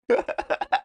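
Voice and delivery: short breathy laugh; a quick airy chuckle; little breathy laugh at herself. Real, not acted. Laughing after her joke.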